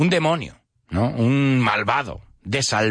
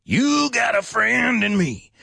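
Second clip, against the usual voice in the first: high, drawn-out raspy singer's voice; 10 semitones higher than usual. Shown as a demonstration. Teacher imitating the song's singer, raspy. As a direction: belt it slowly with a rasp. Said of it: "if you hear that voice, that quality in my voice".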